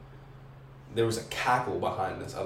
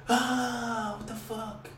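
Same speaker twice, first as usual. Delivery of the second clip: mock scream; 12 semitones higher, 3 dB quieter, much slower than usual. Imitating scream in the dark.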